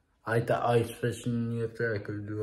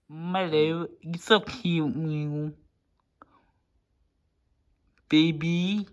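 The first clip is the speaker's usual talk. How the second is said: high, loud sing-song cooing; 7 semitones higher, 6 dB louder, slower than usual. Real, not acted. Cooing at his pet Milo, high and loud baby talk.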